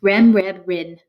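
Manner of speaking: quick run of three nonsense words; said fast. Shown as a demonstration. Teacher testing the listener with a quick list, said fast. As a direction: rattle off the three words, starting loud. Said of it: "say this last one fast".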